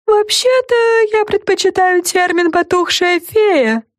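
high, slow haughty correction; proudly. A narrator's reading. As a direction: correct them with pride. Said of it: "гордо заявило привидение".

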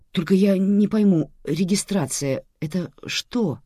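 confused passenger; bewildered. A narrator's reading. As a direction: ask as if completely lost. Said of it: "Мужчина выглядел совсем растерянным".

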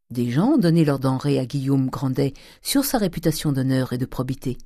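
plain reading voice

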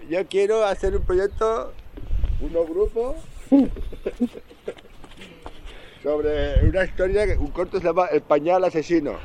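slow, high hammed-up announcer; mock presenter voice. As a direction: announce it like a cheesy pitch. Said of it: "Com s'expliqués".

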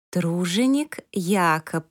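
slow, spelling out each syllable. Acted. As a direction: sound out «Труженик Якоб» one syllable at a time, slowly. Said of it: "произнесла по складам".

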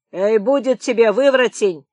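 loud call to stop; irritated shout. A narrator's reading. Guard telling the singing prisoner to stop, shouting. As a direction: shout to cut him off. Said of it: "крикнул Вахрушка".

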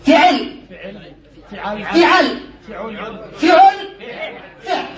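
each فعل starts with fi-, middle vowel changing; فعل with "i" first, then varying vowels; kasra on first letter. Shown as a demonstration. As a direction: recite فعل as fi- forms, one by one. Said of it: "نكسر التاء ونحرك بالحركات الثلاث".

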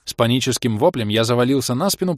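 ordinary delivery

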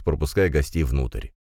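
ordinary delivery

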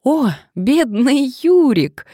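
slow, high, loud cry of delight; beaming recognition. A narrator's reading. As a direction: exclaim joyfully as it dawns on you. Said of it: "просияла Мария".

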